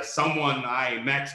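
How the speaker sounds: ordinary delivery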